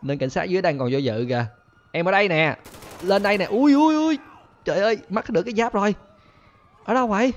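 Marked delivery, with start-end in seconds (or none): 1.9-3.4 higher, louder come-get-me call; teasing call. Real, not acted. Calling the police up to him, loud taunt. 3.4-7.3 very high, louder startled cries; alarmed yelps. Real, not acted. Losing his armor to fire, high alarmed yelps.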